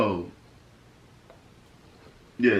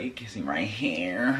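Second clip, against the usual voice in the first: whispered imitation of her; breathy whispered mimic; mock whisper; 7 semitones lower, 8 dB quieter, slower than usual. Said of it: "Look at her. She like".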